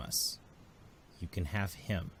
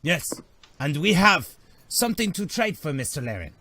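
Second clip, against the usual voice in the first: put-on a lightly higher Nemodian accent, bargaining; Nemodian envoy voice; 5 semitones higher, 7 dB louder than usual. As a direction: haggle like a sly alien trader. Nemodian trading with the crew.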